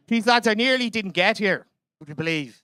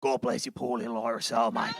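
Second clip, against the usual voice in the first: low, softer mock Aussie pity; Australian accent; 9 semitones lower, 6 dB quieter than usual. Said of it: "he just goes".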